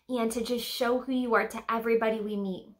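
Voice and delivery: ordinary talk, nothing marked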